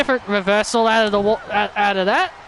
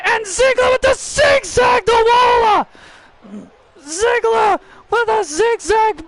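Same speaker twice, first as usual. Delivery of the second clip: excited play-by-play; 8 semitones higher than usual. Real, not acted. Commentator calling Ziggler's attack on Waller, excited shout. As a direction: yell the brawl as it breaks out. Live post-match brawl call.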